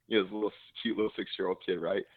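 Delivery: ordinary talk, nothing marked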